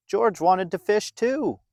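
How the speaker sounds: ordinary delivery, nothing marked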